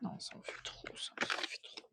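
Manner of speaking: barely audible whisper to oneself; whispered aside. Real, not acted. Card reader to self, too many cards, whispered. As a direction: whisper it under your breath, twice over.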